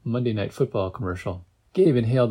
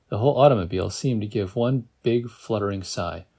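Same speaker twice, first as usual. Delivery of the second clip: ordinary delivery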